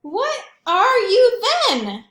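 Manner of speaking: high, slow, loud cry; elephant crying out. Acted. Librarian voices the elephant crying out loud. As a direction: shout it up at the puzzling thing. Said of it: "he cried".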